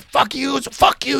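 high, mock angry yell; retold screaming match. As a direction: imitate two men trading insults. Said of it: "they're screaming at each other".

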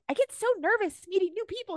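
very high put-on voice; high-pitched, mocking nervous gushing; mock hysterical woman. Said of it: "she's, like, laughing hysterically".

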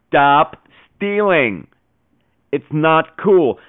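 every word drawn out slowly, like a lecture; mock-stern parental warning. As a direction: lay down the law to the kids, slowly. Host lecturing listeners on piracy.

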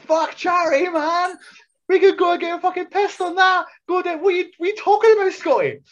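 high, loud, fast rant at his mate; mock outraged yell. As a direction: shout him down in disbelief. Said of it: "I'm like".

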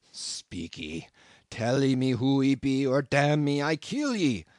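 Queequeg growling at the intruder, building to a roar; growled threat. A narrator's reading. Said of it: "again growled the cannibal".

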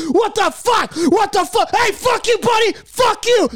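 hammed-up furious driver; road rage. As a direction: yell at another driver. Angry driver cut off.

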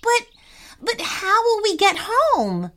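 stammering, high and worried; nervous stammer. Acted. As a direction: stumble over the words anxiously. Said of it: "with a nervous voice".

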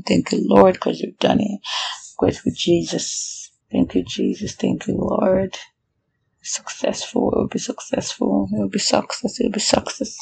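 soft, hushed thanks to God; whispered prayer. Real, not acted. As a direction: pray under your breath.

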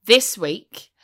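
ordinary talk

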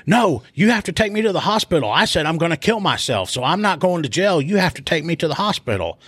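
mock a demanding, worked-up protest; insistent protest. Arrested man refusing jail to officer, imitated insistent protest.

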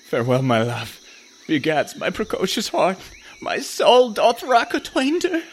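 hammed-up lovesick poet; mock swooning, climbing in pitch; overly dramatic. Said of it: "A little overly dramatic".

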